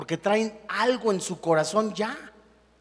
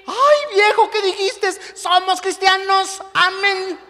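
much higher, louder mock-sweet wife's voice; pious wife; 11 semitones higher, 6 dB louder, slower than usual. Wife rebuking her angry husband in traffic, high mock-sweet voice. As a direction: chide your husband sweetly.

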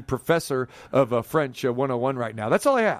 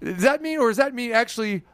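mock eager question to announcer; 7 semitones higher than usual. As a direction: play it out as if already turned to the guy in the booth. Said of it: "Then I say".

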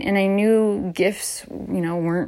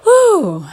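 loud whew; 13 semitones higher, 9 dB louder than usual. Real, not acted. Exhale after emotional talk.

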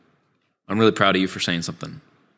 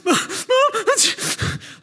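high, cracking imitation of a sobbing teen; ugly crying; much slower than usual. Teen bursting in on his mom, hammed-up ugly crying. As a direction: squeak out the words like a boy bawling. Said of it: "I was, like, ugly crying".

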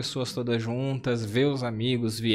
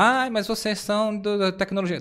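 higher-pitched imitated protest; mock objection; 7 semitones higher than usual. Imagined critic objecting that they are tech people, mock protest.

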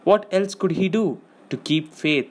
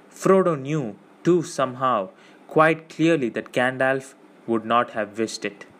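ordinary delivery

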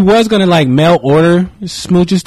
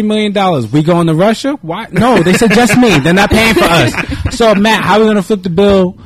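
higher, louder, hammed-up sales pitch to the wife; mock husband pitch; 5 semitones higher, 4 dB louder than usual. Husband imagining pitching a Russia trip to his wife, hammed-up. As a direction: sell the trip like a nervous hype man. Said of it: "I'm like".

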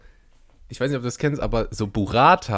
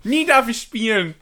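put-on higher whiny kid voice; sulking child; 10 semitones higher than usual. Friend plays the told-off child who wants to keep playing, sulky. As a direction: whine like a scolded little boy.

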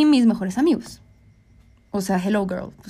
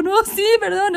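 high placating apology; retold appeasing sorry; mock apology; 10 semitones higher than usual. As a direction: cave in and apologize, pitch shooting up. Acts apologizing to appease him.

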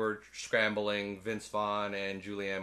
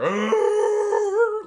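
much higher, louder roar; mock T-Rex roar; 13 dB louder than usual. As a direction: roar like a distant dinosaur. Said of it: "like the T-Rex roaring".

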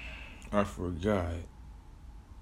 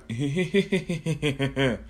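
villain cackle; 4 semitones higher than usual. Acted. Plushie laughs in kitchen.

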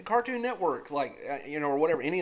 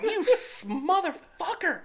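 high, indignant mock rant; hammed-up fury, much higher; mock outrage; 11 semitones higher than usual. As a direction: squeal it like you've been tricked. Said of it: "And it's like".

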